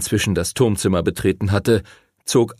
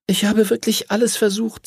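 put-on high-pitched, mildly dejected girl; 12 semitones higher than usual. A narrator's reading. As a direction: plead that you did your best. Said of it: "sagte Lucy niedergeschlagen".

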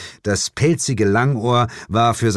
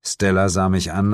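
usual speaking voice

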